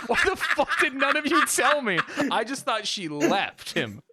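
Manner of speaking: very high, incredulous squeal; mock-outraged high-pitched protest; shocked outburst. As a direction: blurt it out high in disbelief. Acted shocked outburst at friends.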